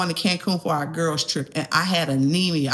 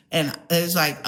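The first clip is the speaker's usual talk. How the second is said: ordinary delivery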